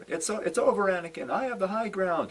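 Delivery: lightly voiced Obi-Wan claiming the high ground; imitating Obi-Wan. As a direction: quote Obi-Wan with a touch of drama. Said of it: "at the end of Revenge of the Sith".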